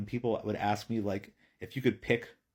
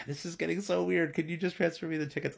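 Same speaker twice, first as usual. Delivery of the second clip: higher-pitched, mildly exasperated inner voice; retold exasperation; 7 semitones higher than usual. Dad thinking about a stranger holding the tickets, mildly exasperated. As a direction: voice a nervous thought in a higher pitch.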